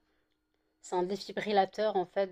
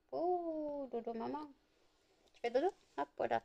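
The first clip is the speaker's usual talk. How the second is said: whispering over a crib; 6 semitones higher, 8 dB quieter than usual. Real, not acted. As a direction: hush a puppy to sleep. Soothing puppy to sleep.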